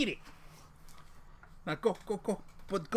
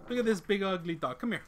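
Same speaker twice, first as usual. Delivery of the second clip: ordinary talk, nothing marked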